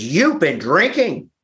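high, slow accusing mother's voice; angry mother. Retold or imitated. Mother confronting her son about drinking, slow angry accusation. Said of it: "She was angry".

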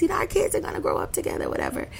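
high, fast, whispered throwaway line; mumbled aside. Retold or imitated. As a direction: toss it off under your breath, fast. Mocking friend's casual dismissal.

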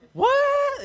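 very high, loud squeal of disbelief; hammed-up shocked yelp; mock astonishment. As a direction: shoot the word way up high. Own astonished reaction, retold.

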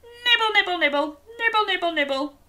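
high playful nibble chant; munch leaves like a giraffe; bouncy nibble nibble for kids; giraffe nibbling. Shown as a demonstration. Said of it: "and go".

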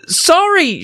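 put-on very high girlish cry; high-pitched outburst; screamed. A narrator's reading. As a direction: blurt it out in an acted scream. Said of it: "she finally managed to scream".

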